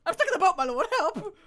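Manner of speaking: high, loud laughing imitation of the panic; hammed-up cry for help, giggling; mock panic replay. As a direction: ham up the cry through laughter. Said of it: "The panic. The actual panic".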